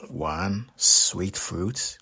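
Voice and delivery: usual speaking voice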